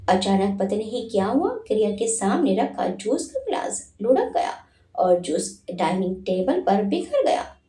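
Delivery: usual speaking voice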